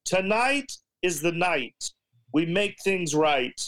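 slow, dramatic slam-poetry delivery; hammed-up poetry slam recital; spoken word poet. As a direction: draw it out like a stage poet. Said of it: "She just goes like this".

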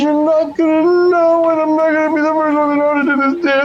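put-on high, worried girl rushing her words; a little girl panicking about the dance; fretful young girl. GM voices anxious girl.

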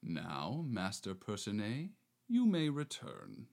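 low, soft aside; softly. Acted. Suitor calling the hidden lover back, low and soft. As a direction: say it softly under the breath. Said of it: "says softly".

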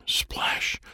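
breathy whispered splash. A narrator's reading. Narrator whispering the big rain splash. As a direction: whisper it like water landing.

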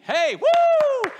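sudden high, loud cheer; excited whoop. Real, not acted. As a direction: whoop like you're at a ballgame. Preacher celebrating Jesus saving him.

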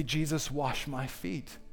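soft, slow plea; offering the congregation a soft, slow prayer; hushed prayer. Shown as a demonstration. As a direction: model the prayer softly and slowly. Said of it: "Just take this moment to say".

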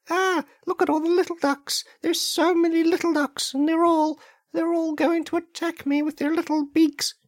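very high, loud hammed-up squeal of terror; panicked shriek. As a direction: shriek like a cartoon victim swarmed by ducks. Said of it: "they're gonna hear this".

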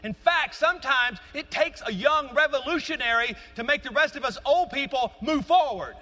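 high, loud, driving sermon delivery; full-force preaching. Acted. Pastor rousing the young congregation, full-force preaching. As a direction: preach it out to the whole church.

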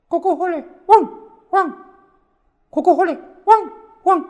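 高く大きな犬の声で; 子犬が吠えるように甲高く; 犬の鳴き真似. A narrator's reading. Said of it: "と鳴きました". (高い声で犬になりきって)

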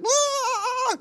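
put-on piercing high toddler wail; high bratty tantrum scream; toddler tantrum shriek. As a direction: shriek like a spoiled two-year-old. A spoiled two-year-old.

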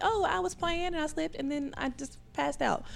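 high, lightly voiced little girl explaining; innocent child. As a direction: answer like an unbothered six-year-old. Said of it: "I'm like".

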